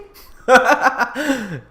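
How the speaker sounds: loud high giggle; high laugh. Real, not acted. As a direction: burst out laughing. Laughs loudly.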